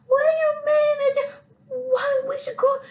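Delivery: very high, strained near-crying; panicked disbelief. Retold or imitated. She reacts to the guide about locals shooting at them, high panicked disbelief. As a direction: voice cracking in shocked fear.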